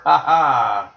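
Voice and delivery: ordinary talk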